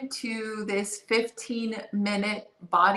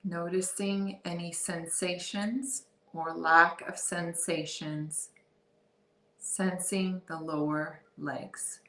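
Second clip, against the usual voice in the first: calm, softer body-scan voice with long pauses; guided meditation; 3 dB quieter than usual. Real, not acted. Guide leading listeners through a body scan, guided meditation. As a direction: ease them into awareness, unhurried and soft.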